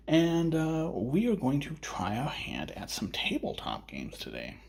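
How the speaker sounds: usual speaking voice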